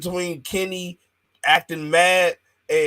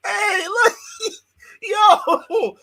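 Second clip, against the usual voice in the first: very high, louder laughing cries; cracking up at the fake fight, excited high yelps; excited shout; 4 dB louder, much slower than usual. Real, not acted. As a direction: yelp it out through laughter.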